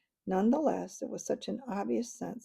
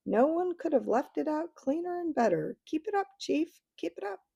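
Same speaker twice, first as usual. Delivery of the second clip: much higher, eager fawning voices; cheering Dufflepud chorus; 9 semitones higher than usual. A narrator's reading. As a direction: egg the chief on, adoring.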